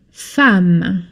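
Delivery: "femme" said fam, with an open a. Shown as a demonstration. Teacher showing the class "femme" said fam. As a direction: say "femme" as fam, never with an e sound. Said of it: "and it's pronounced".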